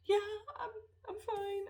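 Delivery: high, tight teary voice, forcing it out; teary brave voice. Retold or imitated. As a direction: pretend you're fine while about to cry. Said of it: "And I'm like".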